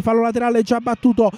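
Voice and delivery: normal talking voice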